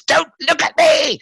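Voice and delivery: high, sharp hissing cry. Acted. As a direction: hiss it out high and desperate. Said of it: "it hissed".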